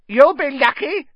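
very high, loud cackling jeer; cackling poltergeist. A narrator's reading. A poltergeist jeers at the headmaster, very high cackling. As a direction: cackle it with glee at the trouble. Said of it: "said a cackling voice".

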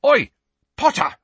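loud, high furious yell across the hall; angry shout from a distance. A narrator's reading. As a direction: bellow his name in a rage. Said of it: "a loud and angry voice yelled".

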